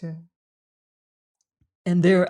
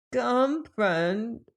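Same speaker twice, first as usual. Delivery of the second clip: strange piping tones; 5 semitones higher than usual. A narrator's reading. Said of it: "said he in strange, piping tones".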